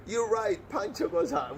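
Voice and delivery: loud, high mock announcer's cry; retold excited shout; excited confirmation. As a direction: whoop it out like a quiz winner's announced. Acting the bartender's excited reply.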